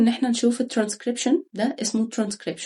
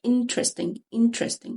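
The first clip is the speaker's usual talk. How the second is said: interesting stressed at the start, IN-teresting; stress on first syllable; 2 semitones higher, slower than usual. Shown as a demonstration. Teacher shows learners where the stress goes, stressed "in". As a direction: punch "in" and let the rest fall away. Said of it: "الضغط اللي متكي على الكلمة في أول مقطع".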